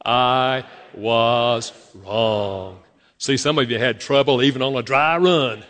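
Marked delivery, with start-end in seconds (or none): 0.0-2.8 word by word, very slowly; drawn-out, separated words for repeating together; slow dry run. Shown as a demonstration. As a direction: lead a group recitation, stretching each word. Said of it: "Let's just say it out loud together".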